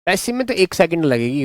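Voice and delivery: ordinary talk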